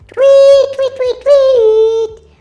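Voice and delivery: loud, slow drawn-out tweets; loud bird chirp. Acted.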